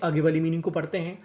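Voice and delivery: ordinary talk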